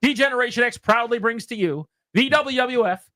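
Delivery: big ring announcer call; ringmaster. Retold or imitated. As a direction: announce it to an arena crowd. Reciting DX ring introduction.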